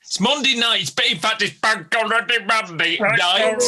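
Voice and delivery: high, beaming party host greeting; overbright kids party host. Acted. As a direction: pump everyone up like a kids' entertainer. Host greets quiz players.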